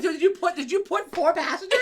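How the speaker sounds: high, loud incredulous question; retold disbelief. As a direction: question it in comic alarm. Retelling disbelief at the tiny car.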